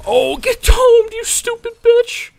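very high, drawn-out loud gloat; triumphant taunting yell. Real, not acted. Gamer taunting a player just killed, triumphant yell. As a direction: stretch a shrieked taunt over the kill.

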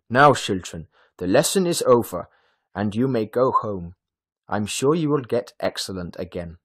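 projected, kindly classroom voice; smiling teacher. A narrator's reading. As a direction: send the children off with a smile in the voice. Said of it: "smiling at the children".

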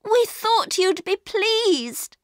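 put-on high, drawn-out child's protest; dismayed little girl, slow and high; upset cry. A narrator's reading. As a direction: plead in a hurt, high voice. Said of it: "she cried".

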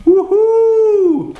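a real delighted woohoo; cheer. Real, not acted. Cheering at own low price.

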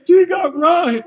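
high, loud wailing plea; mock crying shout. Run crying to Rick, loud high shout. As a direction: wail it like a begging kid. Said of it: "I never forget you crying".

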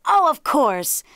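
high, loud jackal exclamation; crying out. A narrator's reading. Jackal accepts the correction, crying out. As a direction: exclaim it as if suddenly understanding. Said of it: "cried the jackal".

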